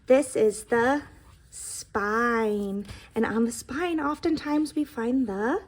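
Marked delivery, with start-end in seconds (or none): 0.1-2.9 slow teaching. Shown as a demonstration. Teacher naming book parts.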